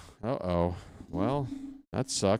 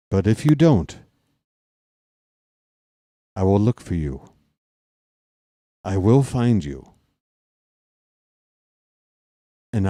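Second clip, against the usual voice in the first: menacing movie threat; 3 dB louder than usual. Pretend. Warning the neighbour to stop streaming, cold menacing movie voice. Taken speech parodied at neighbour.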